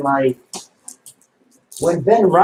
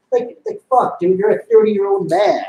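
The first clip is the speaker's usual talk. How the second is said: ordinary talk, nothing marked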